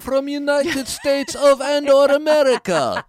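high, loud defensive broken English; mock foreign accent. Defensive Polish man answers the comic in a high mock accent. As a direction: bristle in a put-on foreign accent.

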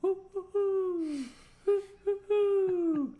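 very high, loud drawn-out hoots; eerie falsetto hoo calls, the last one held long; imitated weird hooting. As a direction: hoot like a mysterious night creature. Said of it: "hearing this, like, weird, weird noise".

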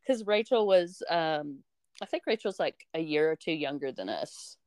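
ordinary talk, nothing marked

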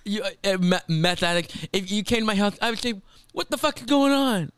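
high-pitched, halting mock outrage; Dorinda impression. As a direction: imitate a reality star sputtering in indignation. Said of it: "it's one of her best".

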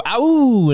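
high loud playground wolf howl. Retold or imitated. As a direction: howl like a kid playing wolf. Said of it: "and we'd go".

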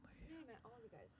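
hushed fast whisper. Real, not acted. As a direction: drop to a quick whisper. Sudden hushed aside.

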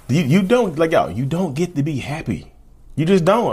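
high, mocking scold; mocking narcissist voice. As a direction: sneer it like a jealous partner. Mock narcissist scolding partner.

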